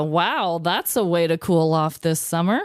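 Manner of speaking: ordinary talk, nothing marked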